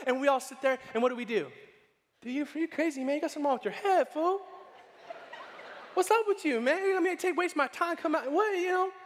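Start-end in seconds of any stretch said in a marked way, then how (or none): 2.2-8.9 higher, hammed-up indignant rant at a friend; mock indignant rant. Moviegoers berating their friend, hammed-up indignant rant. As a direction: play the fed-up moviegoer, half joking. Audience voiced ranting at friend.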